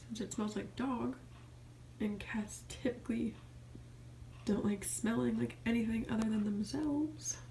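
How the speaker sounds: slow, soft, gentle voice to a cat; soft pet talk. Real, not acted. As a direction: murmur tenderly to the cat. Cooing to the cat.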